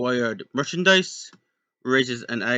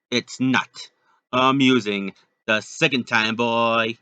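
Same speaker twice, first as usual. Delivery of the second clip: louder stern pirate captain; 8 dB louder than usual. Acted. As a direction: warn the boy gruffly. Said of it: "the pirate captain said".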